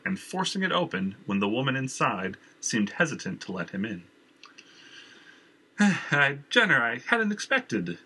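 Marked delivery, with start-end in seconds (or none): none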